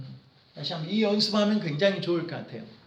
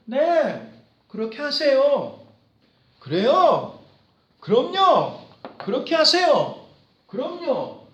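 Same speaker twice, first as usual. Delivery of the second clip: cheerful permission; 5 semitones higher, 6 dB louder than usual. Acted. Positive boss granting leave.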